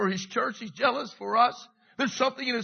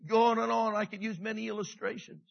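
usual speaking voice

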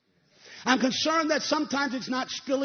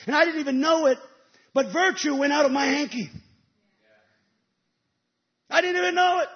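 louder, fervent preacher's proclamation; preaching; 4 semitones higher, 8 dB louder than usual. Acted. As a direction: proclaim the wonder to the congregation. Preacher lifts voice on testimony.

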